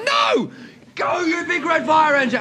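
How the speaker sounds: very high, exasperated shout; acted high-pitched rallying cry; insistent yell. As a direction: yell it to fire up the crowd. Cocky fireman demanding more.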